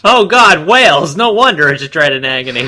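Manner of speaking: loud high exclamation of mock dismay; mock horror. Real, not acted. Co-reader jokes about Wales, loud mock-horrified yelp.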